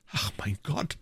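understated fright under the breath; horrified exclamation. A narrator's reading. Queen alarmed by his talk of death, hushed horror. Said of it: "mit einem Ausdruck des Schreckens".

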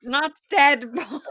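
slow laughing repeat. Pretend. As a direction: laugh through the title. Repeating joke title.

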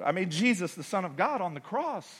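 ordinary talk

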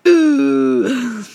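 ordinary talk

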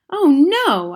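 high, loud dismayed cry; sudden loud boyish dismay; cried out. A narrator's reading. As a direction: wail it like a crushed boy. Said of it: "I cried".

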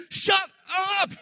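telling sorrow and grief it holds no power, shouting; defiant shout. Retold or imitated. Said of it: "you're saying".

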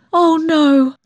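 high, emotional protest; almost sobbing. A narrator's reading. Daughter vowing to spare her mother, high and nearly sobbing. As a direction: pitch it high, on the verge of a sob. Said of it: "I almost sobbed".

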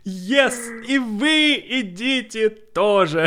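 high, slow cheery reply; Russian dub impression. Replying in the Russian version of that scene, high cheery call. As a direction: answer in a drawn-out, gleeful film voice. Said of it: "the Russian version of that scene".

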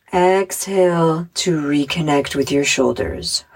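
measured meditation voice with long pauses; unhurried guided relaxation, phrase by phrase. Acted. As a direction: guide children gently through breathing and imagery. Leads a guided visualization.

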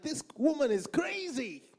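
high, exasperated exclamation; retold shouting. Preacher reliving rows with his wife, exasperated shouting. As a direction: exclaim it high, in mock outrage. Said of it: "I was shouting like an African person".

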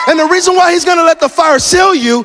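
high, loud shouted sermon; full-force preaching. Real, not acted. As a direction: preach it at the top of your voice.

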